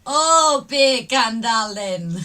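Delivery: loud Jamaican-accented delivery. Shown as a demonstration. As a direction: say it with a playful Jamaican lilt. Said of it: "beer can without sounding Jamaican".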